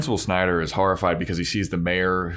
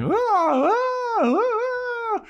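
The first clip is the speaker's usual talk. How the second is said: panicked screams. Retold or imitated. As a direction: shriek in terror, short bursts. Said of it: "And he's like".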